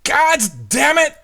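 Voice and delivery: high, loud curse from off to the side; frustrated shout. Acted. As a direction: blurt it out in sudden annoyance. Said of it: "and it just shouts".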